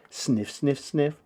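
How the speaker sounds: ordinary delivery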